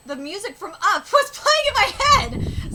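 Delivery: loud, laughing exclamation; excited outburst. Real, not acted. Excited outburst about film music.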